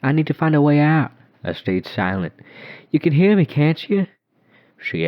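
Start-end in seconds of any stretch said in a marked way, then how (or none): 0.0-1.1 put-on high, louder, urgent child plea; ghost girl pleading. A narrator's reading. Lost ghost girl pleading with narrator, high and urgent. 2.9-4.1 put-on high, louder, startled child; surprised ghost girl. A narrator's reading. Ghost girl realising narrator hears her, surprised and high.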